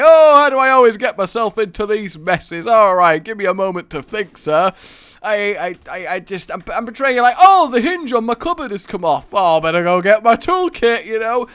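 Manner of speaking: high, loud chirpy hapless victim; mock-innocent victim wailing at a mugger; kooky old me. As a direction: play a cheerful sitcom klutz getting mugged. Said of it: "kooky old me".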